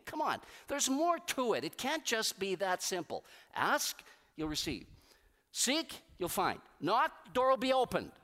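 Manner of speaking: ordinary delivery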